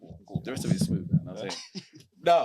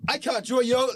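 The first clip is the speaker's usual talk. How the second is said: higher, louder insistent repeat; emphatic plea; 10 semitones higher, 5 dB louder than usual. Real, not acted. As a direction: push it, pleading with him. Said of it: "So I can't, I can't draw you out".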